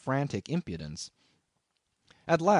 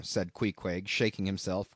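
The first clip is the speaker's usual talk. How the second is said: ordinary delivery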